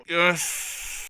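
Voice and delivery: normal talking voice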